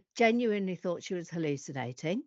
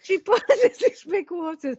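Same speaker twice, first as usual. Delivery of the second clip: laughing; 9 semitones higher, 6 dB louder than usual. Real, not acted. Laughing while telling it.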